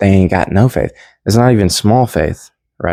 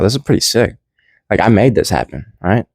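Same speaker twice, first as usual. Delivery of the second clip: normal talking voice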